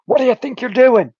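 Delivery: put-on high, shrill-free Irish accent, pitched well up; the doused trucker yelps in a put-on Irish accent. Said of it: "I'm giving him an Irish accent".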